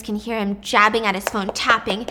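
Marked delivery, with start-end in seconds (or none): none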